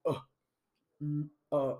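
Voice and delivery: slow, halting, lightly voiced Holmes; Elizabeth Holmes impression. Imitating Elizabeth Holmes answering the one word interview question. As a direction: hesitate then answer with a touch of her earnest deadpan. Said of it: "and she's like".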